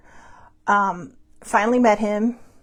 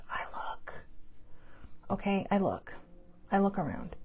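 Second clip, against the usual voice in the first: mock-hushed, gazing around, much softer; hushed demo; 13 dB quieter than usual. Shown as a demonstration. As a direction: quietly act out looking around. Acts out looking up from phone.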